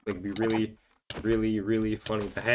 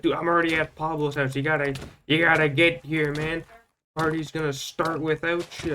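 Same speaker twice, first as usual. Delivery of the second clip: pushy friend; 6 semitones higher than usual. Retold or imitated. Exil insisting party started.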